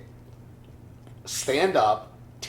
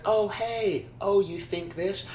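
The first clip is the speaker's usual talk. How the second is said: mock interest; 8 semitones higher, slower than usual. Acts out old college saying.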